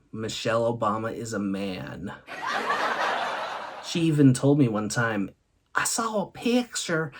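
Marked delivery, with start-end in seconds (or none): 5.8-7.1 put-on a light Southern grandma accent, higher; Southern accent, gently voiced. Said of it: "she has a Southern accent".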